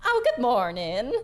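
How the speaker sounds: folksy innkeeper's hello; cheery Skinny greeting. Acted. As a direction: sing out a friendly morning greeting. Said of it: "She goes".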